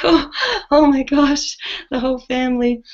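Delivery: high, exasperated oh my gosh after a laugh; mock dismay. Mother reacting to her second son falling ill, high exasperated oh my gosh. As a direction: throw up your hands, half laughing.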